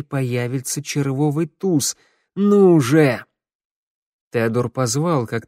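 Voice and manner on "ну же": louder, higher insistent plea; urgent call. A narrator's reading. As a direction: call out, urging it to appear. Urgent repeat of whispered call.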